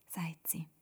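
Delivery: ordinary delivery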